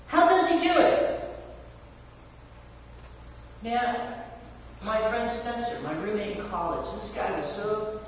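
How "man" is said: usual speaking voice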